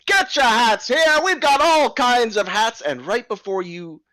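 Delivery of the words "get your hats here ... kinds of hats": high, loud street-vendor call; a hat seller crying his wares; hat seller shouting. Acted. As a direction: hawk your hats to the crowd. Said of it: "you hear him as you get closer shouting".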